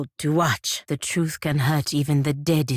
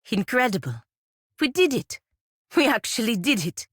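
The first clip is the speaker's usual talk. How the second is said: higher, thrilled disbelief; rising joy at the success; excited triumph; 7 semitones higher than usual. Acted.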